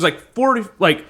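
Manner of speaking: ordinary talk, nothing marked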